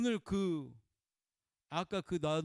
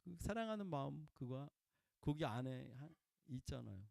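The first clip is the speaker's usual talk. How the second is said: much softer, tender admission; gentle smiling confession; 3 semitones lower, 9 dB quieter than usual. Retold or imitated. The hurt woman telling of her love for God, soft and tender. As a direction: say it softly with a small smile. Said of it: "웃으면서 또 얘기해서".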